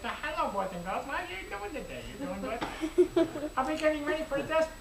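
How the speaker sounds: a gentle teacher greeting little pupils, high and sing-song; sweet teacher voice. Shown as a demonstration. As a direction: coo at the kids like a sweet schoolteacher. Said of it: "I can talk to you like that".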